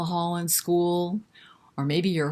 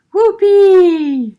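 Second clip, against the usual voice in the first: very high, very loud whoop; excited exclaim; 13 semitones higher, 14 dB louder than usual. Acted. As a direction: shriek it with glee. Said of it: "answers Pam".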